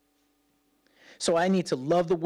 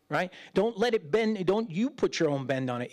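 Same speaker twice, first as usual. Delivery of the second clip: usual speaking voice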